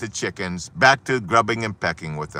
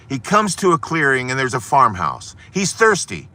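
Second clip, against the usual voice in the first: normal talking voice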